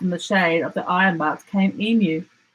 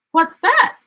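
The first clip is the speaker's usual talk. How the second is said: curious animals; 12 semitones higher, 5 dB louder than usual. A narrator's reading. Animals ask together, high.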